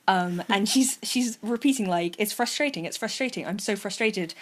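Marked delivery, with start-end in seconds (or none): none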